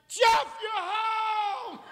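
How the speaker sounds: very high, drawn-out greeting; mock excited boys. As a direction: imitate overjoyed boys, high and slow.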